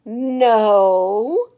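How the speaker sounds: a loud, flat refusal; stubborn loud no. Acted. Stephanie refusing her mom's ponytail offer, loud and stubborn. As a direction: plant your feet and refuse.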